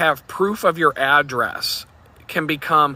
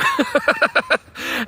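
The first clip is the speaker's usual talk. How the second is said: short high bitter laugh. Real, not acted. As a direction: laugh at how absurd it got. Laughs at his own bad luck.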